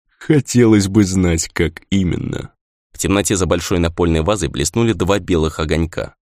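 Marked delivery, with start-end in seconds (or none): 0.1-2.5 creaky spirit voice. A narrator's reading. Spirit Kodzu speaking.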